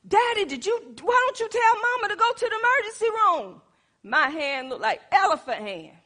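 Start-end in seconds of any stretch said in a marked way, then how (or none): 0.1-3.6 put-on high, urgent young son voice; a worried boy begging his mother; son's voice. Son appeals to father.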